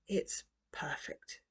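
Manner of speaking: slow, whispered; whispered awe. A narrator's reading. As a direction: breathe it out in awe. Grandpa moved at sunset.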